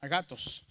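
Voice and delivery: "agatos" más grave, con GA marcada; acento en GA. Shown as a demonstration. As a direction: carga la voz en GA de "agatos" y deja caer TOS. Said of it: "este va a aumentar". ("agatos" acentuado en la penúltima sílaba)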